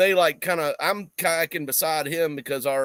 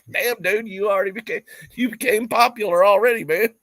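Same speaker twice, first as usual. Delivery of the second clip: teasing exclamation; 6 semitones higher, 5 dB louder than usual. Retold or imitated. Teasing friend, retold.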